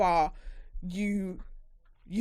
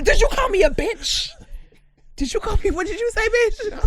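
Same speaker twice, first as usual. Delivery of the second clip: high, loud mock-offended outburst; fly off the handle like a drunk; angry drunk; 11 semitones higher, 6 dB louder than usual. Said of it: "get really angry when they're drunk".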